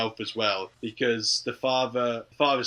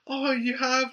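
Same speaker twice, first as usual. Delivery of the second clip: much higher, gasping "oh, you have?"; mock surprise; 13 semitones higher, slower than usual. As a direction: feign delighted shock, pitched way up. Sophie voiced, mock surprise.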